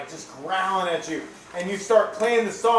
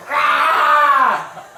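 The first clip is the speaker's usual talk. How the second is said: a sharp, high yelp of pain, louder; sudden pain cry; 10 semitones higher, 12 dB louder than usual. Acted. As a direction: cry out as the lizard bites. Lizard bites your arm.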